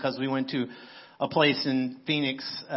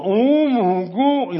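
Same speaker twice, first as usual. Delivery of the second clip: higher, louder and slow, overwhelmed; imitating awed delight; 7 semitones higher, 9 dB louder, much slower than usual. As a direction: stretch it out like the taste floored her. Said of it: "she can't even say the words".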